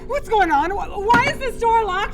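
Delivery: high frantic yelling; panicked shouting. Acted. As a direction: pound the door and yell. Locked in, panicking at the door.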